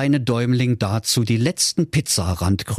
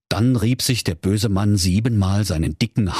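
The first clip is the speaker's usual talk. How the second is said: ordinary delivery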